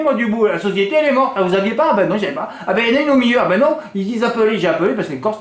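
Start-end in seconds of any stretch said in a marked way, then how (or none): none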